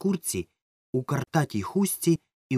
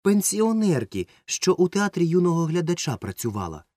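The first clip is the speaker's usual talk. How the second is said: more cheerful; 9 semitones higher, 4 dB louder than usual. A narrator's reading. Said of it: "вже бадьоріше докинув Ромка".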